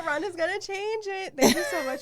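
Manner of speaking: giggling, teasing her friend; laughing. Real, not acted. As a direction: laugh through the words while teasing. Laughing teasing after her quote.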